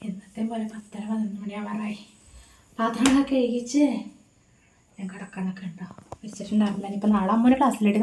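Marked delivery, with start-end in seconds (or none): 0.1-2.0 hushed whisper so as not to wake anyone. Real, not acted. Late night, household asleep. 5.0-6.9 hushed whisper so as not to wake anyone. Real, not acted. Late night, household asleep.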